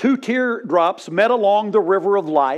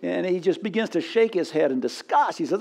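ordinary delivery

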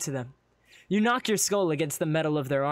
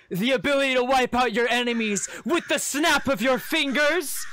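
high, loud grandiose proclamation; grand cloaked figure; 10 semitones higher, 5 dB louder than usual. Acted. Cloaked figure offering you power over enemies, grandiose. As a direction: sell it like a showman. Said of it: "raises his arms grandly".

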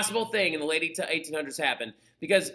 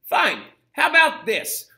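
higher, louder mock challenge; acted challenge; 9 semitones higher, 7 dB louder, slower than usual. Teacher voicing Bismarck's answer to the socialists, challenging. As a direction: throw it out like a bold counteroffer.

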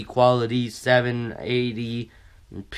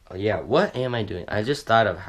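ordinary delivery

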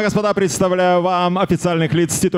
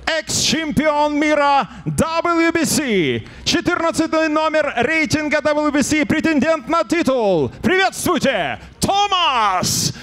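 rising hype, stretched final name call; fighting game announcer; 6 semitones higher than usual. Real, not acted. Ring announcer introducing the challenger.

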